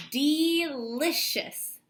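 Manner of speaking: Delicious drawn out very slowly; very slow stretch. Shown as a demonstration. Teacher models the full word for students, very slow. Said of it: "as many letters as I can hear".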